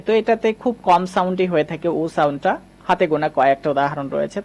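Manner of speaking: normal talking voice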